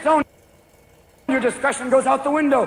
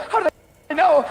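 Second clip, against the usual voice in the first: paranoid panic; 7 semitones higher, faster than usual. Retold or imitated. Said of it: "Man stoned on freeway".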